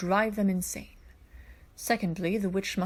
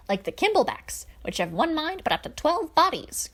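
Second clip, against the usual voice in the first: put-on higher, louder, hearty old-fashioned gentleman; eccentric Coran voice; 8 semitones higher, 4 dB louder than usual. Coran declaring to the paladins, higher and louder. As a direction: play it like a jolly, grandiose royal advisor.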